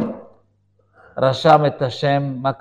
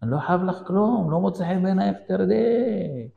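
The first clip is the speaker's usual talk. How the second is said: fed-up driver; 7 semitones higher than usual. Retold or imitated. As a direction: tell off the passenger without shouting. נהג עונה לנוסעת צועקת. (נהג שנמאס לו)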